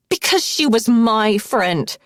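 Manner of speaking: furious, loud outburst; snarled. A narrator's reading. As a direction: spit it out at her. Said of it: "Cass snarled".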